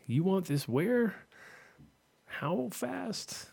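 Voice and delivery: high, slow incredulous question; mock shock. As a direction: act stunned by the shipping cost. Mock customer shocked at shipping.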